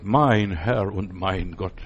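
low, very slow, awed prayerful voice; reverent slow confession. Shown as a demonstration. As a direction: let each word sink in low and slow. Repeats confession, moved.

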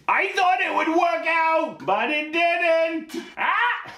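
very high, slow comic screech; Gilbert Gottfried. Acted. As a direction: screech it like a squawking parrot, ending in a cry. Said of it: "all voiced by Gilbert Gottfried".

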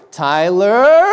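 loud worried call of a name, high. Retold or imitated. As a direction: yell the name from the back room. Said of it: "it kinda ended like a".

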